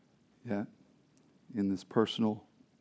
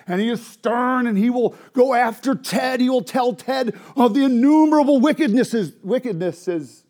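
full-force preaching; 11 semitones higher, 12 dB louder than usual. Real, not acted. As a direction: cry it out to the congregation.

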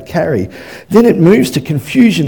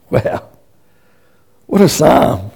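ordinary talk, nothing marked

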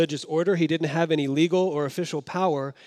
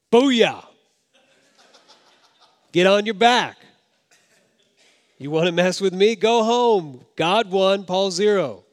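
loud, high, taunting trash talk; hammed-up victory yell, gloating; mock gloating shout; 4 semitones higher, 6 dB louder than usual. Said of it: "That's not what the voice says".

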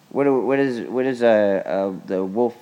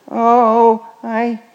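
whiny mom voice; 7 semitones higher than usual. Pretend. Voices mommy finger character.

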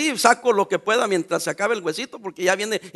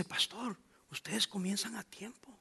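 susurro asombrado; 19 dB quieter, slower than usual. Retold or imitated. Other pastor's startled question.

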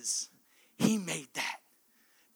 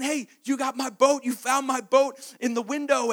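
excited; 9 semitones higher, 6 dB louder than usual. Acted. Boy confronts the shop owner.

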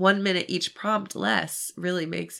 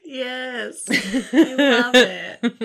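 slow, laughing delight; 7 semitones higher, 7 dB louder, much slower than usual. Real, not acted. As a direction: gush with a laugh.

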